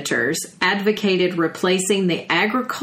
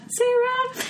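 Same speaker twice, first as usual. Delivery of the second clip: high-pitched name call; 3 dB quieter than usual. Real, not acted. Shout out to a friend.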